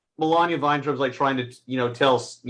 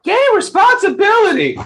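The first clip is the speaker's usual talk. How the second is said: much higher and louder, a mock celebration; sarcastic cheer; 9 dB louder than usual. Real, not acted. Teasing a tired friend about working all weekend, loud sarcastic cheer. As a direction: fake enthusiasm that means the opposite.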